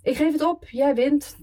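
wailing I give up, pitched high; drunk surrender. Acted. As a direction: throw up your hands vocally.

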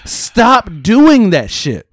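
exasperated imitated shout at customers; mock yell at kids. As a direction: yell at a kid wrecking the shoes. Yelling at kids ruining shoes.

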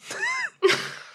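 shrill burst of laughter; high squealing laugh. Real, not acted. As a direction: crack up high. Laughs at own reading.

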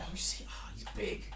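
hushed amazed comment; whispered remark. Real, not acted. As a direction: whisper it in awe. Seeing a photo.

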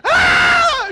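high, loud startled mock shriek; startled scream. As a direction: yelp back in fright. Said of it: "And my wife goes".

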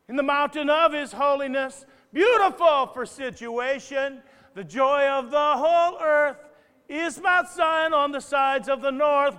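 loud, high, fervent sermon voice; full-force preaching. Acted. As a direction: preach it to the back row.

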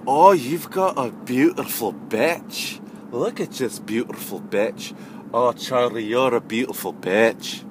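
slow, gushing mock European accent; emphatic European accent. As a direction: coo over the dog in a drawn-out European accent. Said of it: "like, emphatic".

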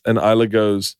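ordinary talk, nothing marked

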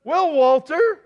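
high and loud, shaken; stunned reporter. Pretend. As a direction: reporter barely holding together. Reporter replies on air.

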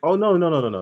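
playful protest. Real, not acted. Playful rebuttal to co-host.

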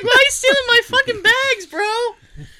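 very high, loud comic outrage; mock alarm. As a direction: squeal it in exaggerated indignation. Mock panic at door guys grabbing bags.

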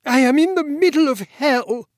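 furious outburst, pitched high. A narrator's reading. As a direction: snap back in a rage. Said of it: "said Scythrop furiously".

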